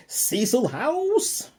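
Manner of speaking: loud, high, drawn-out proclamation; announcer-style bellow. Shown as a demonstration. Reviewer hamming up the dorm name for fun, grand announcement. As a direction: boom out the name, stretching the vowels. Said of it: "I just wanted to do it that way".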